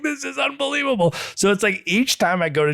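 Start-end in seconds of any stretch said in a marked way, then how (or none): 0.0-1.1 much higher, breathless with wonder; reliving the awe of the island trip; amazed gush. Retold or imitated. Traveller recalling Miyajima ryokan stay, high excited gush. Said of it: "And I was just like".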